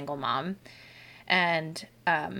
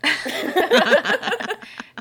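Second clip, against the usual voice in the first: high burst of laughter; laughing; 6 dB louder than usual. Real, not acted. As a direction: let out a loud, high laugh. Laughs at sister's news.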